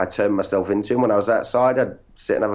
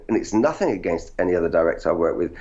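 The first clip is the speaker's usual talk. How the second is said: ordinary delivery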